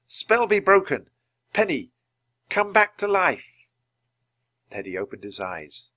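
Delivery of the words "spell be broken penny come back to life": high, loud magical command; spell incantation. Acted. Teddy casts a spell to revive Penny, commanding incantation. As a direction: chant out the spell, stretching the last words. Reciting a spell over the penguin.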